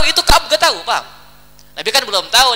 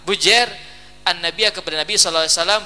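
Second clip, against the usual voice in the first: ordinary delivery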